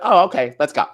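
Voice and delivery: high, loud, excited jump-in; eager agreement. Retold or imitated. Fan offered a picture right away, loudly eager. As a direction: pounce on the offer.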